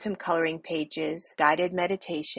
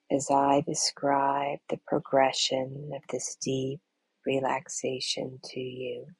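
very slow, low, hushed meditation voice; slow, soothing body-scan delivery; guided meditation; 3 semitones lower, slower than usual. Acted. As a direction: ease them into deep relaxation with long pauses.

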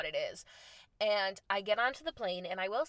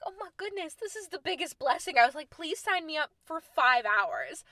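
overjoyed gushing; 7 semitones higher, 4 dB louder than usual. Retold or imitated. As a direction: gush like you found a miracle. Delighted at the nap rooms.

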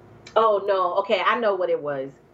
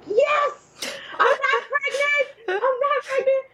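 much higher, gleeful cheer; high squeal of relief; relieved cheer; slower than usual. Retold or imitated. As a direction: celebrate escaping it, high and bright. Relieved at negative test.

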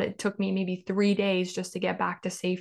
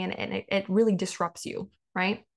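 ordinary delivery

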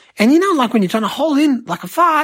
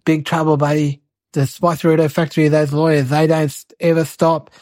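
put-on lower, tough policeman warning the kid; a hint of a hard-boiled cop, pitched down; gruff cop voice; 6 semitones lower than usual. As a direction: play the stern cop, dropping low. Cop threatens the boy.

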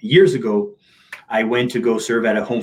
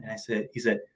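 normal talking voice

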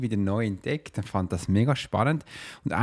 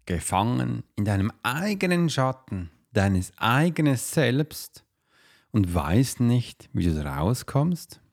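right in your ear. Acted. Dramatic podcast teaser intro.

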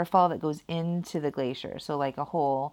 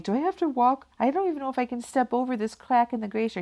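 scared; 9 semitones higher, 4 dB louder, faster than usual. Retold or imitated. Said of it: "And I'm like".

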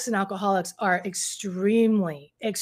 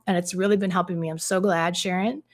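ordinary delivery

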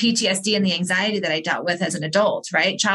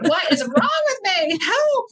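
high, slow, pleading cry for help; a hammed-up anguished high plea; desperate plea; 10 semitones higher, slower than usual. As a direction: wail it out like you're at the end of your rope. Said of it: "I was like".

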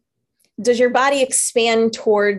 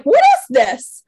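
high, loud, slow disbelief; mock outrage; incredulous; 13 semitones higher, 7 dB louder, slower than usual. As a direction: stretch out your shock. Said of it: "I'm like".

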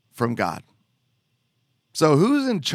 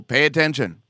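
ordinary talk, nothing marked

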